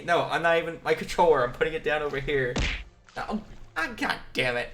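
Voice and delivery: usual speaking voice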